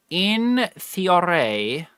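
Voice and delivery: drawn-out in theory. Shown as a demonstration. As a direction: draw out in theory like a tease. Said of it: "repeated phrase".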